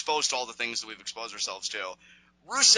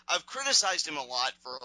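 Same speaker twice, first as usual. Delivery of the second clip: ordinary talk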